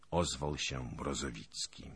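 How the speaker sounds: plain reading voice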